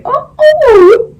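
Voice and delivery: high loud startled oh, oh; mock startled cry; startled yelp. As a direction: yelp like you got a fright. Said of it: "and I was like".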